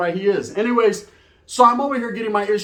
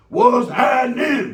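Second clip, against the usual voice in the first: slow, loud, higher greeting shout; drawn-out shout; 6 semitones higher, 4 dB louder, much slower than usual. Pretend. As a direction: stretch it out and yell the hello across the lot.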